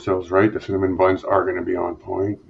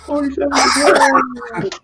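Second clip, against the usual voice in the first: mock announcer; slower than usual. Echoes percent in high drawn voice.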